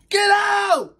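frantic yell. Real, not acted. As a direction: yell the mouse out of the box. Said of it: "I was only yelling".

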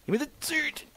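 high squeaky demand. Acted. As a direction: squeak it like a cartoon.

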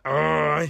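demonstrated groan of displeasure; unhappy groan. Shown as a demonstration. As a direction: groan out loud as if fed up with the news. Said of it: "จำเสียงแบบไม่แฮปปี้ละ".

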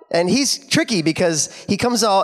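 ordinary delivery

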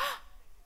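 sharp surprised gasp. Acted. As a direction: catch your breath in shock. Sudden recognition.

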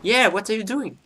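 high-pitched pretend mum speaking English; English mother. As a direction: mimic a mother asking in English. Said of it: "elle va parler en anglais".

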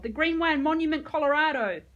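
loud, high pleading yell; desperate yell. A narrator's reading. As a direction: yell it to be believed. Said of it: "Batiste shouted it out".

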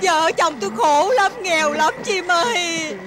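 drawn-out, tearful begging; wailing plea. Acted. Wife pleading with the bird over their only tree, wailing. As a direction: lament your poverty in a theatrical wail. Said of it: "Ông cứ kêu la đi".

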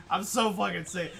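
normal talking voice

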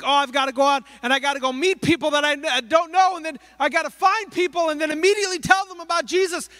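rushed worry. Retold or imitated. Churchgoer worrying about evangelism, rushed fretting. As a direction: blurt the to-do list in a panic. Anxious believer's rushed thoughts.

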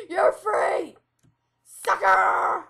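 loud high taunting yell; gloating shout. Pretend. Gloating in game.

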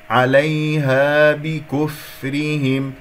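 عليها slowly, no stress on the ي; correct slow demo. Shown as a demonstration. Teacher repeating عليها slowly without the stress. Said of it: "عليها صار نبر".

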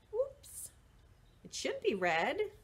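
ordinary delivery

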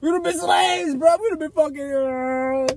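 high, cracking fake cry; half-joking whimpering cry; mock wailing. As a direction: wail it like a hammed-up sob. Mock wail over slavery.

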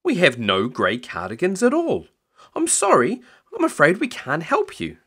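high, swooping shop assistant voice; apologetic young salesman. Acted. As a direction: apologize with the pitch leaping up.

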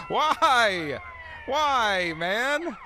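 exasperated wail. Real, not acted. Player wailing after a mishap in the game, exasperated. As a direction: wail it out in frustration.

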